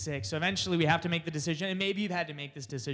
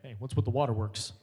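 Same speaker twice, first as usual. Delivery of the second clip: dad quote; 6 semitones lower, 3 dB louder than usual. Retold or imitated. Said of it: "he's like".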